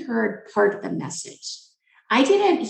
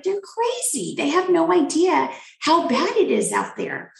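scoffing dismissal; 7 semitones higher than usual. Retold or imitated. Scoffing at complaining colleagues.

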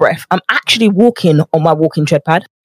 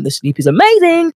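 high, delighted rave; 10 semitones higher than usual. Real, not acted. Podcaster raving to listeners about sleep on the walking pad. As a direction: gush about how well you sleep.

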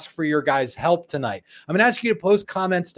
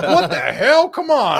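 high, loud Southern protest; hammed-up Southern driver, half laughing; Southern accent; 8 semitones higher, 7 dB louder, slower than usual. Host mocks angry Prius drivers, Southern accent. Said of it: "suddenly has a Southern accent".

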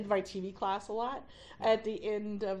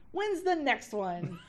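laughing; 8 semitones higher, 3 dB louder, slower than usual. Retold or imitated. Student quoting herself, laughing.

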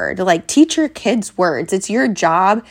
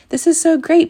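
pitched up, louder praise; high gushing praise; 10 semitones higher, 3 dB louder than usual. Shown as a demonstration. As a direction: cheer them on, bright and high. Praise example.

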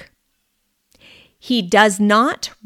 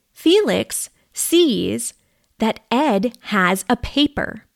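plain reading voice

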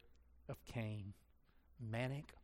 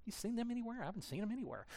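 mock clueless God; 8 semitones higher, 3 dB quieter, faster than usual. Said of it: "He's not going".

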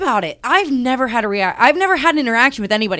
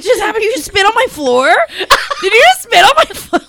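high, loud, laughing outrage; real shocked disbelief; 9 semitones higher, 4 dB louder than usual. Real, not acted. Friend shocked after seeing spit on her floor. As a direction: gasp it out, amazed.

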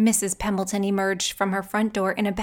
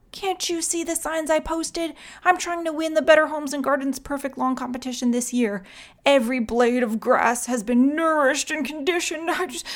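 higher, fretful neighbour voice; fussy complaining man; 6 semitones higher than usual. A narrator's reading. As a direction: whine about the lawn.

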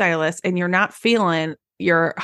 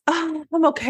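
chirpy answer; 9 semitones higher than usual. Retold or imitated. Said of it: "The client asking the stylist".

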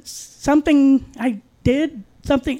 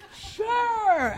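sarcastic; 9 semitones higher, 4 dB quieter than usual. Real, not acted. Sarcastic reply to her story.

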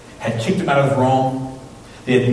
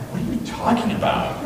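higher-pitched puzzled question; acted bafflement; 10 semitones higher, 4 dB quieter than usual. Pastor answering the Cuban translators, acted bafflement. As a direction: play baffled, voice jumping up. Acts out his baffled reply.

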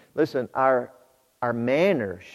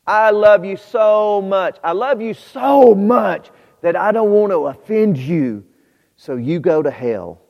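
mock sweet love; 7 semitones higher, 8 dB louder than usual. Preacher mocking a friend who stays silent, loudly sugary. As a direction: overdo the sweetness sarcastically.

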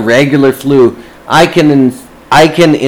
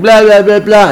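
mocking the clerk; 9 semitones higher, 3 dB louder than usual. Mimicking the clerk showing off.

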